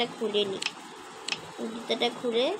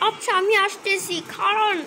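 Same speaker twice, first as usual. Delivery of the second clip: higher, slightly different voice for the driver; taxi driver; 7 semitones higher than usual. Acted. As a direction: answer quickly as the cab man on the line. Taxi driver answers on phone.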